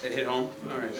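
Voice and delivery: ordinary talk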